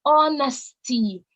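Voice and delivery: honesty drawn out slowly, with extra weight; emphasize honesty, stretching the whole word; stress on honesty. Shown as a demonstration. Speaker to young listeners stressing a pledge word, honesty slow and emphatic. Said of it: "Allow me to stress and emphasize on that word".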